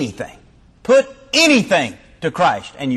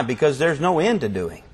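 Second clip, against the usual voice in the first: ordinary talk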